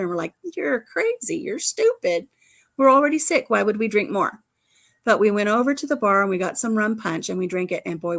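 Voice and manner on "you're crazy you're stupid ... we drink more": mock outraged, climbing high in pitch; hammed-up disbelief at silly advice; incredulous protest. Us answering the resort staff, mock incredulous. Retold friends rejecting hair of dog.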